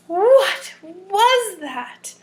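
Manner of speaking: dazed mother bear drawing it out; lightly voiced Mama Bear. Reading to children, mama bear after Goldilocks flees, slow.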